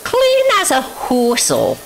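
high, drawn-out whistle-like flourish; playful high squeal. Pretend. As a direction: stretch it out high and silly. Playful first take, then repeated lower.

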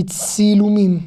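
"Tsilumim" with an initial ts; "Tsilumim" said carefully as an example word; pronunciation demo. Shown as a demonstration. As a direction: model "Tsilumim", opening on t fused into s. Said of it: "vous avez entendu comment ça se prononce".

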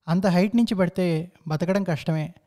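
ordinary delivery, nothing marked

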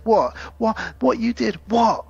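a touch of angry disbelief; mild mock anger. Husband gets angry with his wife, mock indignant. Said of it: "he gets angry with her".

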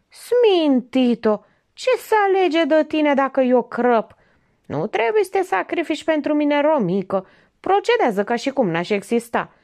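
husband scolding his mad wife; exasperated husband. A narrator's reading. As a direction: scold her in despair.

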